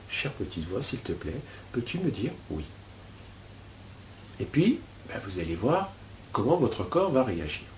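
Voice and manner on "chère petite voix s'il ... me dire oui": hushed, gentle asking; soft inner question. Shown as a demonstration. Teacher to students, asking the inner voice: hushed and gentle. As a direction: ask your inner voice softly, almost to yourself. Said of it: "donc vous posez la question".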